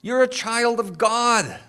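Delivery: high, loud, rousing preacher's cry; full-force preaching. Real, not acted. Pastor rallying his congregation about God as Father, rousing. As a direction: lift the whole room with it.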